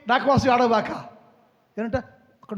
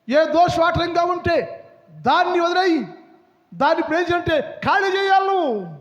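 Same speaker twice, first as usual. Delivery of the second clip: raised, driving sermon voice; fervent preaching; 6 semitones higher, 4 dB louder than usual. Acted. Pastor urging the congregation to repent, raised and fervent. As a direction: drive it home to the church.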